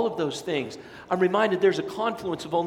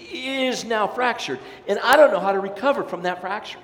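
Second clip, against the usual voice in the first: loud, high, driving preacher voice; preaching at full force; 6 semitones higher, 6 dB louder than usual. Acted. Preacher warning the congregation about fractured lives, loud and urgent. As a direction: build the sermon up and push it out.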